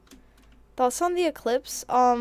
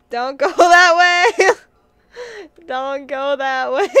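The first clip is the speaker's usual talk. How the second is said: loud, stretched-out yell breaking into laughter; drawn-out hammed-up shout with laughs; laughing mock shout; 6 semitones higher, 13 dB louder, slower than usual. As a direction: holler a mock warning, cracking up. Repeats yelled warning, breaking into laughter.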